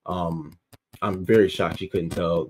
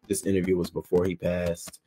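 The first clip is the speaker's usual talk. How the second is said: ordinary talk, nothing marked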